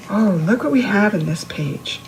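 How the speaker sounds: ordinary delivery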